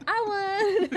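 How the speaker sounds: laughing mock winner voice; high, loud teary gloat; imitating Miss Colombia. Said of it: "who's just like".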